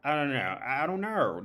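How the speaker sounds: mock Steve Irwin Aussie voice; playful Australian accent. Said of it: "what's the dude who died, who was the, the animal guy".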